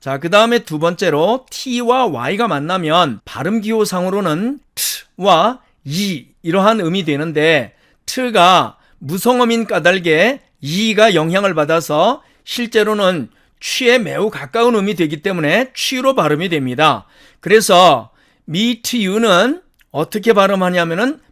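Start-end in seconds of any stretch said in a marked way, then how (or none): none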